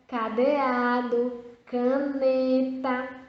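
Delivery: cadeado and caneta slowly with a plain ka, no U; slow syllable demo. Shown as a demonstration. Teacher showing the ka sound without U. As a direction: stretch each word, hard ka. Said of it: "não tem o som do U".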